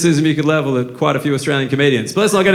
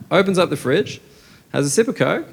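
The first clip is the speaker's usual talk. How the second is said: ordinary talk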